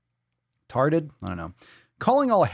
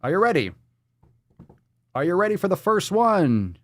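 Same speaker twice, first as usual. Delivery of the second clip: normal talking voice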